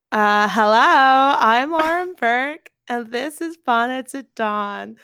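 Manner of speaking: a lightly put-on American accent of her co-host, slow and loud at the start; mock Lauren accent. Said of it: "We've all heard my accent of you".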